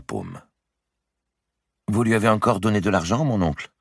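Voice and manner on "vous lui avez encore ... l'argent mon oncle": reproachful. A narrator's reading. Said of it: "en hochant la tête avec réprobation".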